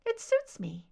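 high, plain and unbothered; just state it, lightly and high; a simple high reply from the captor; said simply. Acted. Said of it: "her captor said simply".

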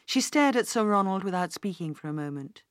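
usual speaking voice

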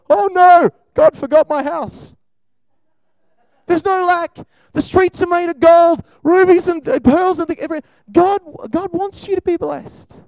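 high, loud excited preaching; preacher exclaiming about heaven's streets of gold; preaching at full force. Real, not acted. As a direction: paint heaven with rising excitement.

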